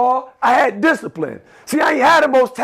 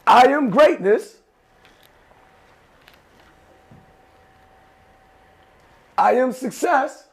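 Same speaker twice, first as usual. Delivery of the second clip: slow, measured call for repetition; slow chant for the class to echo; slow affirmations; 3 semitones higher than usual. Shown as a demonstration. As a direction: let each word land slowly. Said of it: "I'ma say it slow".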